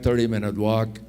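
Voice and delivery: usual speaking voice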